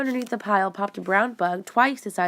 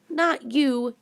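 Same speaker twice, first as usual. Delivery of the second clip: snapped; 6 semitones higher than usual. Acted. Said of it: "Neil snapped".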